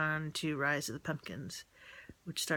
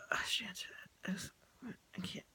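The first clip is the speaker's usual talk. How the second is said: whisper; 12 dB quieter, much faster than usual. Retold or imitated. Retelling being too drained to text.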